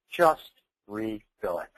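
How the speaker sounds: low, slow, clipped-off order; slow curt demand. Retold or imitated. Snooty customer ordering the clerk around, slow and low.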